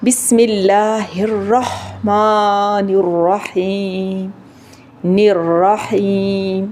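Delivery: very drawn-out "بسم اللہ الرحمن الرحیم" with thin throaty ح; measured tajweed chant with long vowels in "الرحیم"; slow recitation, clear haa. Shown as a demonstration. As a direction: recite slowly, keeping ح distinct from خ in "الرحیم". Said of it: "ہا میں فرق ہو جائے".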